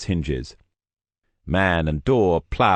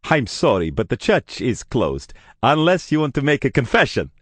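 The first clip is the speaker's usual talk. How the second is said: strained joke from a worried priest; nervous levity; 8 semitones higher than usual. Acted. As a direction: try a joke that falls flat. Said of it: "a failed attempt at levity".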